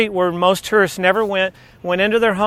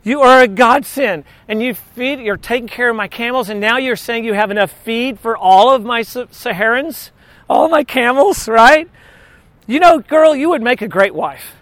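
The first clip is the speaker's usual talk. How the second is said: excited servant; 3 semitones higher than usual. Retold or imitated. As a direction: gush with excitement over the godsend. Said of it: "He's like".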